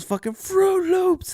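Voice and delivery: high, drawn-out concert yell; imitated scream. Imitating a concertgoer screaming out random cereals, high mock yell. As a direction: stretch it out in a high mock holler. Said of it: "he started screaming out".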